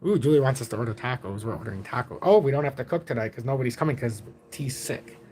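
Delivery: low and soft, holding the volume down; hushed low voice. Real, not acted. Dad relaying the taco order while son sleeps, hushed low voice. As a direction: keep it quiet and low. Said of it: "I can't get loud".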